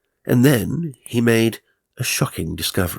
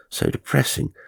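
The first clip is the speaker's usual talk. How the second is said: plain reading voice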